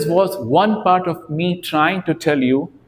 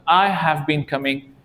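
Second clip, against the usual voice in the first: ordinary talk, nothing marked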